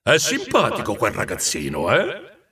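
put-on giant voice, rising "eh?"; amused ghost. A narrator's reading. Ghost asking Scrooge about the boy, teasing. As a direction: nudge him with a jovial question.

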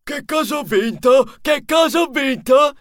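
high, impatient character voice; excited high-pitched questioning; trembling eagerness. A narrator's reading. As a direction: beg to know your prize, pitched high. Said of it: "chiese trepidante Trappola".

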